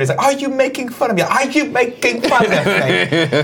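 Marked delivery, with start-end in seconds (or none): 0.2-1.2 high-pitched mock British accent; nervous British indignation, pitched way up; Michael Caine. Shown as a demonstration. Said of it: "you get into a British accent". 1.2-3.4 slow, high, exaggerated British accent; drawn-out mock British indignation, laugh creeping in; Michael Caine. Shown as a demonstration. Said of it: "more and more Michael Caine".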